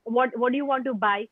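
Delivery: ordinary delivery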